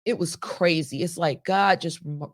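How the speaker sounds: normal talking voice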